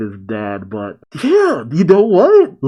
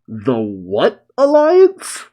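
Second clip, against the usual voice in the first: slow, incredulous question with rising end; indignant huff; much slower than usual. Acted. Kid hearing the alliance's name, indignant huff. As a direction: stretch it out in mock affront. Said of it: "And Kid just huffs".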